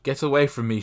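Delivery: brief hammed-up yell; narrated shout. A narrator's reading. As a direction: shoo the gulls off with a yell. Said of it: "she shouts".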